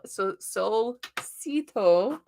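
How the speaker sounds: Solcito sounded out very slowly, part by part; careful name pronunciation. Real, not acted. Reader unsure of a viewer's name, sounding out Solcito slowly. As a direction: feel your way slowly through the name Solcito. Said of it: "if I mispronounce that".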